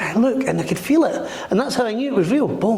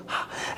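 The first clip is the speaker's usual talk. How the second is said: sharp gasp; 13 dB quieter than usual. Retold or imitated. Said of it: "you're like".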